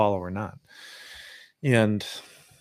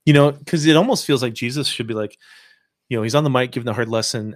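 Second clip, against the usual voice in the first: ordinary talk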